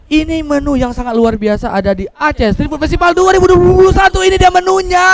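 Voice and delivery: rising announcer voice, building up; building announcer hype. Acted. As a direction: announce the menu like a host. Segment announcement, pitch building. (suara pembawa acara yang makin bersemangat)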